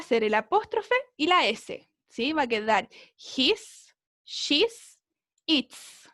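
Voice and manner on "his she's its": slow teacher demo of his, she's, its; pronounce contractions. Shown as a demonstration. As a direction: sound out each contraction. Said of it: "va a sonar igual".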